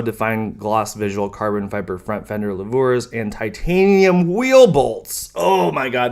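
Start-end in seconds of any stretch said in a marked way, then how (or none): 3.2-5.3 slow, exaggerated sarcastic gushing. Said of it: "Sorry for being sarcastic". 5.3-6.1 sarcastic. Acted. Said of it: "Sorry for being sarcastic".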